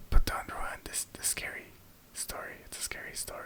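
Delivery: hushed, breathy ghost-story whisper; mock-eerie whisper to protect the scary story; spooky whisper. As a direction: whisper it like around a campfire. Spooky hush over ghost story.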